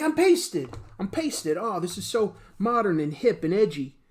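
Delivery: high, loud mock despair; hammed-up cry of defeat; mock victim. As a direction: wail like a pasted hero. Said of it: "And they're like".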